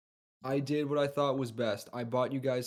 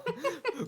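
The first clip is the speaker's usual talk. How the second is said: high giggle. Real, not acted. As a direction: burst of high laughter. Player cracks up mid-description.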